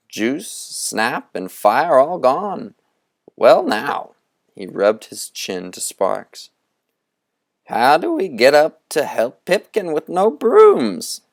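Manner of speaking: Moundstrout preaching. Acted. Conjurer rousing the boys to build a cathedral, grandly. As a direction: build it up like a fiery sermon on cathedrals.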